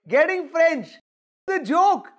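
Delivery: ordinary delivery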